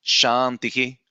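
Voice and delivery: "Shantihi" held on "Shan", echoing i at the end; chant-like "Shantihi" with an echoed last vowel; echoed ending with pause. Shown as a demonstration. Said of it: "and we need to pause".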